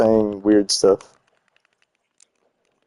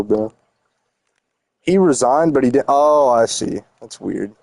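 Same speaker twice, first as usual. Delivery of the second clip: normal talking voice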